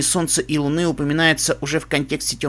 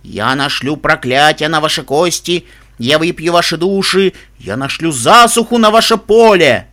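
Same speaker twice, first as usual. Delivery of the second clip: booming echoing voice; 6 dB louder than usual. Acted. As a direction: boom it out like an unseen witch. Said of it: "Невидимая пони продолжала распаляться". (гулкий зловещий голос отовсюду)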